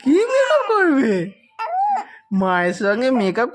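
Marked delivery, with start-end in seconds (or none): 0.0-1.1 playful amazement. Pretend. Adult echoes a small child's makeup wish, playfully amazed. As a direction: ask in a high, playful swoop.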